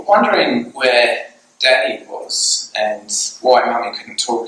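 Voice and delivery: ordinary delivery, nothing marked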